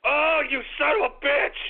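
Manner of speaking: ordinary talk, nothing marked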